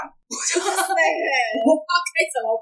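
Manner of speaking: ordinary delivery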